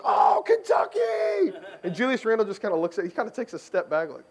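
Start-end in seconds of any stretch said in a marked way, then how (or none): 0.0-1.6 high, drawn-out excited holler; mock delighted shout of recognition; imagined overjoyed reaction. Player as the fan hoped he would react, overjoyed shout. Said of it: "I think he expected, like, Julius Randle to be like".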